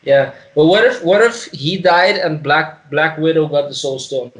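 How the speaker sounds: normal talking voice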